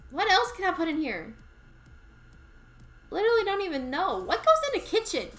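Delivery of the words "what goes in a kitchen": usual speaking voice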